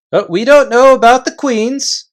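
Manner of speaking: loud, high chieftain calling a halt; gruff invisible chief. Acted. Invisible chief halting the Narnians, loud and high. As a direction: bark the warning from thin air.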